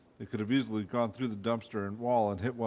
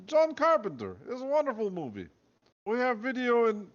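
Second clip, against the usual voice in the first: high, louder hammed-up Russian accent; 13 semitones higher, 4 dB louder than usual. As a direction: chirp it out in a squeaky fake Russian voice. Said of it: "the Russian accent there".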